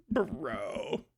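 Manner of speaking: normal talking voice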